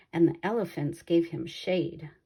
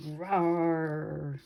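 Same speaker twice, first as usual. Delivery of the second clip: lion roar. Acted.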